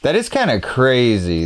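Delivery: ordinary delivery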